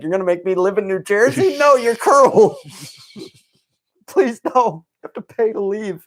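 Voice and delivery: high mock pleading, slowing on the cruel part; high, mocking plea; mock whiny Wanda. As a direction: whine it like a sulky sitcom wife. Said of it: "She's like".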